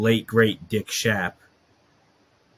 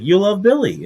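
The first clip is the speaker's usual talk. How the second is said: excited pitch; 10 semitones higher, 4 dB louder than usual. Retold or imitated. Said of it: "He's like".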